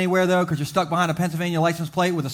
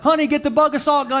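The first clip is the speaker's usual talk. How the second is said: wife; 8 semitones higher, 4 dB louder than usual. Retold or imitated. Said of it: "your wife is like".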